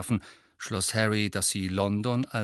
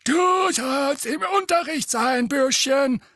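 high, loud, slow scolding croak; croaking gargoyle; 7 dB louder, slower than usual. A narrator's reading. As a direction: croak it down at the boy like a stone gargoyle. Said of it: "krächzte der eine". (krächzender Wasserspeier, hoch und laut)

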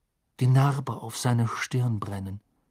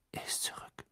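faint, voiceless whisper; 16 dB quieter than usual. A narrator's reading. Harry telling Dumbledore who is back, whisper. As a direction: barely get it out under your breath. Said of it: "flüsterte Harry".

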